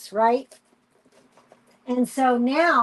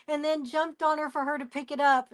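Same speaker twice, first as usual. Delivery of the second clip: ordinary talk, nothing marked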